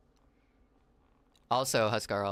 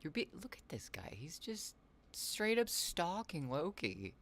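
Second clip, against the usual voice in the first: whisper; 7 semitones higher, 5 dB quieter, slower than usual. Real, not acted.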